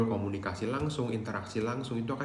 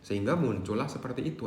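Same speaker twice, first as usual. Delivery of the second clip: ordinary delivery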